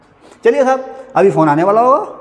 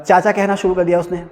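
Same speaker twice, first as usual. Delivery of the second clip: ordinary delivery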